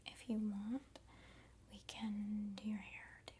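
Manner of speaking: usual speaking voice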